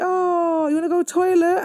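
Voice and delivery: put-on high, sing-song decoy question to the kids; sweet innocent mum voice. Mother to her children, covering the escape, in a high, sweet, innocent voice. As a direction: sound casual and innocent so nobody suspects. Sneaking suitcases out past hotel staff.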